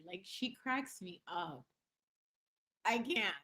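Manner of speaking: ordinary talk, nothing marked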